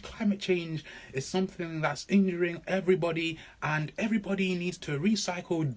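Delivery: mock the gushing eco-campaigner a little. Imitating an over-eager activist for the audience. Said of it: "the kind of imagery that we have about someone who's passionate".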